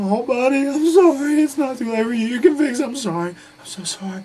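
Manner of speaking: very high, loud wailing apology; hammed-up blubbering, imitating a man crying like a baby; mock crying. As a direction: wail it out high and loud, mockingly tearful. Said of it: "he's just crying like a baby".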